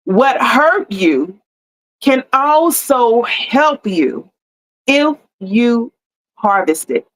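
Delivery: very slow, each phrase set apart by pauses; slow emphatic repeat. Shown as a demonstration. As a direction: drag it out so it lands. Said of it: "I wanna say it like this".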